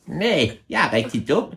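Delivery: very high squeaky creature voice; tiny high creature voice; ævle bævle voice. Acted. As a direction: squeak back like a cheeky hidden creature. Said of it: "sagde ævle bævle stemmen".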